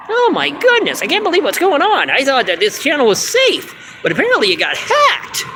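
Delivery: high frantic puppet voice; panicked puppet. Acted. Puppet panics about hacked channel.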